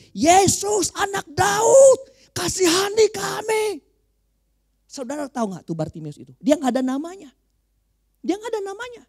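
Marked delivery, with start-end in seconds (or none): 0.1-3.6 loud, high, drawn-out plea; crying out. Acted. Blind beggar calling to passing Jesus, crying out. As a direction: shout slowly and high for mercy.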